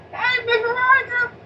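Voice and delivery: high, drawn-out holler across the street; calling from afar. Retold or imitated. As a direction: imitate a friendly yelled greeting, loud and high. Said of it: "he yell at me from wherever he was at".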